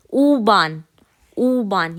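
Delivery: "ऊबान" with a long round oo; German U sound. Shown as a demonstration. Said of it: "ये कैसे बोलते हैं डॉइच में". ("ऊबान" लंबे ऊ के साथ)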